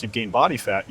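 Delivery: normal talking voice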